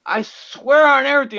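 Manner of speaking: high, loud, exasperated oath; emphatic retold swearing. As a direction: swear it with heated disbelief. Acting out own disappointed reaction.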